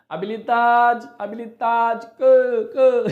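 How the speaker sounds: high, loud mock dog call. Teacher pretends to call puppy for food, calling out. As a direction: stretch out the stressed syllable as if calling a pet. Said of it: "chama o cachorrinho".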